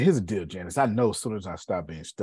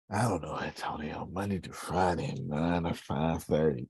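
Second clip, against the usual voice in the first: timid excuse; 5 semitones lower, 7 dB quieter than usual. Retold or imitated. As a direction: whisper it like a hesitant nine-to-five worker. Mocking a doubter's excuse.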